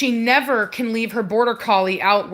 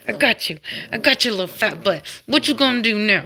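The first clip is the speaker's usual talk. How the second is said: usual speaking voice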